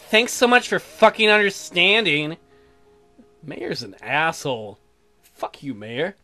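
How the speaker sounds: usual speaking voice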